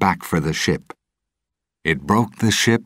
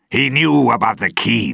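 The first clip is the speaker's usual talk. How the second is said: shouting; 7 semitones higher, 6 dB louder than usual. A narrator's reading. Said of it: "Jones shouted".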